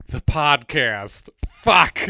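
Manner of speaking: slow then a loud burst of a curse; mock dismay. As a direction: drag it out then blurt the swear. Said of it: "I immediately thought".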